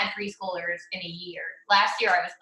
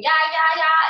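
put-on high loud childish yeah; mock kid chanting yeah; imitating child; 7 semitones higher, 6 dB louder than usual. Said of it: "she was doing".

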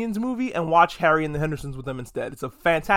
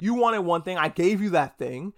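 ordinary delivery

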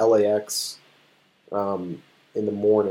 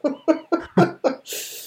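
high burst of laughter. Real, not acted. Laughs at friend's gravelly voice.

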